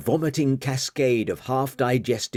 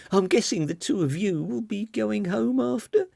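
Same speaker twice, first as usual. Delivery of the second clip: put-on young woman voice; 10 semitones higher than usual. Marian hurrying to leave.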